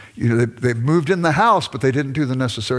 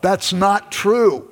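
slow, higher-pitched self-correction; out loud; 7 semitones higher, 3 dB louder, much slower than usual. Retold or imitated. Husband corrects himself aloud before his wife, emphatic. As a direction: correct yourself out loud, drawing it out. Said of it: "out loud".

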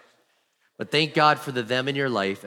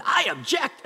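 much higher, squeaky comic cry; mock objection; 13 semitones higher, slower than usual. Pastor joking he nearly objected at council: high mock outburst. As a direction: blurt it out in a jokey high voice.

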